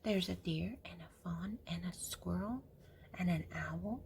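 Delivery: put-on soft hushed whisper to a child. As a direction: whisper it like a secret. Grandma hushing over picture book.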